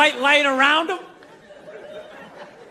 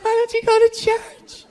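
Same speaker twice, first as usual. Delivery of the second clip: put-on higher, pleading churchgoing girlfriend voice; mock-worried girlfriend begging him to go to church; girlfriend imitation; 6 semitones higher, 4 dB louder than usual. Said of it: "She's like".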